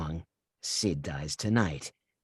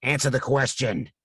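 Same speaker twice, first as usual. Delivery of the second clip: loud, high snapped order; furious demand; 6 semitones higher, 8 dB louder than usual. Acted. Killer shouting at the stalling victim to answer. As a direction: force her to answer now.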